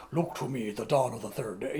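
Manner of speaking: low, hushed hint of a wizard's solemn voice; Gandalf voiceover. Retelling the movie's voiceover at dawn, a low hushed hint of a solemn wizard. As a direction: lightly voice an old wizard's echoing promise. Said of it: "the voiceover".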